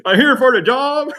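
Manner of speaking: high, loud, laughing mock-innocent announcement; laughing self-quote at a job lobby. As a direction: chirp it through a laugh like you belong there. Walking in homeless, disheveled.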